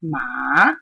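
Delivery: "ma" slow, low dip, then rising; third-tone "ma", a slow low scoop. Shown as a demonstration. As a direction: let "ma" sink and swing back up. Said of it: "If I use the third tone".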